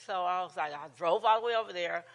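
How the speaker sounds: ordinary talk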